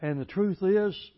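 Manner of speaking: loud, high, driving pulpit voice; fiery preaching. Acted. Preacher to church, loud fiery sermon delivery. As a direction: preach it hard to the congregation.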